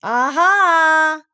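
high, loud, drawn-out "Aha!"; long high cry; anxious aha. A narrator's reading. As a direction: call it out hoping the others hear. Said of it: "looking round anxiously for the others".

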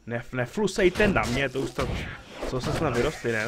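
playfully scolding an NPC for spitting; mock telling off. Telling off a spitting game character.

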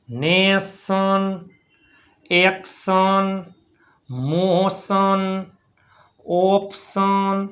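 each word drawn out slowly for learners; slow spelling drill. Shown as a demonstration. Teacher slowly sounding out words for students. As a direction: stretch every syllable so students follow.

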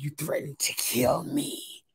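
slow, soft mock imitation of Leah. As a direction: lightly mimic her, slow and hushed. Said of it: "talking like".

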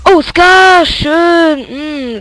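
loud delighted cry; excited shout. Real, not acted. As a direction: shout with sudden joy. Finds a weapon he wants.

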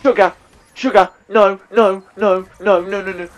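sudden alarmed outburst; panicked exclamations. Real, not acted. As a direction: blurt it out in alarm, repeating fast. Panics as zombies close in.

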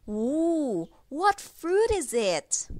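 high gasping playful question; mock surprise. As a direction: gasp and ask like a big reveal. Guessing game for children.

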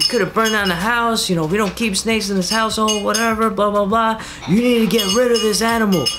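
hammed-up mom laying down rules; imitating mom. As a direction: lecture like a fed-up mom. Mom scolding about fire.

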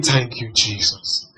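very soft, slow and low; retold tearful thanks. Pastor recalls his bereaved mentee thanking Jesus, hushed and low. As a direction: imitate her murmured thanks through grief. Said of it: "she was crying. And she was saying".

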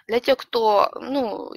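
usual speaking voice

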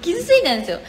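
playful pouting insistence, pitch up; mock hurt protest. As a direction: whine 傷ついたんですよ like a wounded kid, playfully. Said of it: "傷ついてたね。".

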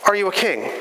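ordinary talk

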